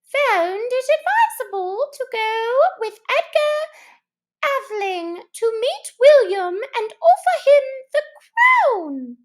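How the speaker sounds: high, loud, pressing-on mouse voice; hurried Mouse. Acted. Mouse hurrying on with the history, high and loud. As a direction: rush on with the lecture. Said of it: "hurriedly went on".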